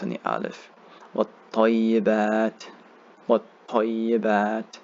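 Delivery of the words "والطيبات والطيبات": wat-tayyibat with held consonant and long final a. Shown as a demonstration. Teacher showing two counts on the alif, wat-tayyibat extended. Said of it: "you hold this for two counts".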